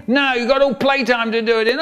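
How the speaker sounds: high fast brisk refusal; teacher briskly refusing a pupil. Retold or imitated. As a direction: brush the child off.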